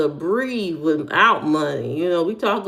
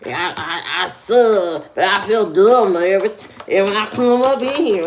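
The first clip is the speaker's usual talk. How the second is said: mocking quote; 4 dB louder than usual. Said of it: "Talking about".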